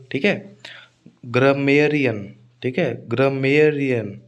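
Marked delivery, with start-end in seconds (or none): 1.2-2.3 slow ग्रमेरियन with a long मेय; stress on मेय. Shown as a demonstration. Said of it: "मेय पर stress आएगा". 3.1-4.2 slow ग्रमेरियन with a long मेय; stress on मेय. Shown as a demonstration. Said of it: "मेय पर stress आएगा".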